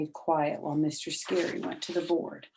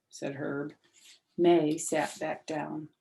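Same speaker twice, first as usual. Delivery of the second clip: usual speaking voice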